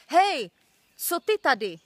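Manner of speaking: put-on old shaman challenges the boy, shouting; gruff call. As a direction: call him out sharply. Angry old man calls out.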